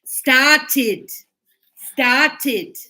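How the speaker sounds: "Started" loud, with a separate -ed syllable; broken into syllables. Shown as a demonstration. Teacher modelling the past tense, broken up loudly. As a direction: break "Started" into two clear beats. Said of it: "we're gonna break it up".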